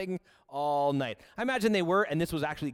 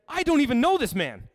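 loud denial; 10 semitones higher, 8 dB louder than usual. Acted. Peter denies Jesus, voiced.

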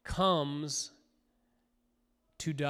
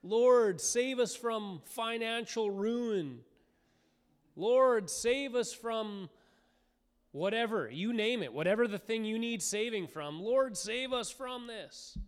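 higher pleading prayer voice; crying out to God; 6 semitones higher than usual. Retold or imitated. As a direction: beg the Lord for rescue. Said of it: "we cry out".

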